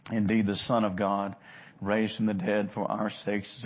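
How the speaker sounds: ordinary delivery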